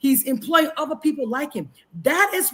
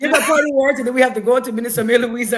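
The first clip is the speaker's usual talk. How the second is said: louder, pressing to finish; host cutting guests off to stay on schedule, louder; hurried wrap-up; 6 dB louder than usual. Real, not acted. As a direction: push everyone along, loud and brisk.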